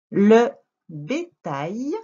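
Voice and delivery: "Le bétail" very slow with a gap after "Le"; word-by-word reading. Shown as a demonstration. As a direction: read "Le bétail" like a first reader, piece by piece. Teacher reads sentence word by word.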